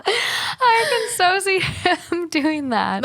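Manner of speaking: real laughter breaking up the words; slow, squeaky words through giggles; laughing hard while she talks. Real, not acted. Listener laughing at the story.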